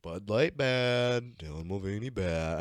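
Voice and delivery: very slow dumb caveman. Retold or imitated. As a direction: grunt it like a caveman. Mocking caveman slogan.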